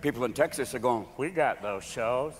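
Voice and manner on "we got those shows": slow, low, quiet drawl; laid-back slow Texan; Texan locals. Acted. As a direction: drawl it lazily, understated. Acts people in Texas.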